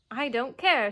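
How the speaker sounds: put-on loud, sulky child; sulky outburst. A narrator's reading. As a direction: snap it back defiantly. Michael sulking loudly.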